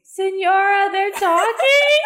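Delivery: high whiny tattle; tattling kid. Acted. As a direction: tell on them to teacher.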